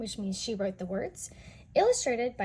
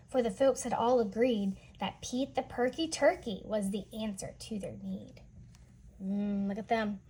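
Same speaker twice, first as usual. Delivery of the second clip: ordinary delivery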